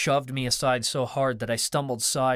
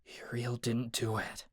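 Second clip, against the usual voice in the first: softer, kept down among others; low voice; 9 dB quieter than usual. A narrator's reading. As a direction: keep it low so others don't hear. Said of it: "so I kept my voice low".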